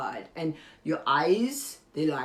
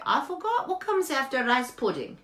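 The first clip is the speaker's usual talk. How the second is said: mock forgetful student; 8 semitones higher than usual. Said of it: "You're gonna say".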